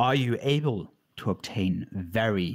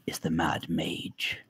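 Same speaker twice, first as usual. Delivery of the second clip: hushed, low and softer; ominous whisper; 7 semitones lower, 5 dB quieter than usual. Acted. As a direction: whisper the dread name. Ominous reveal of a name.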